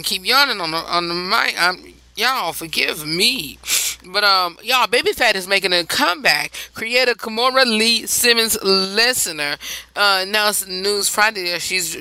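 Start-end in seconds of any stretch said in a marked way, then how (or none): none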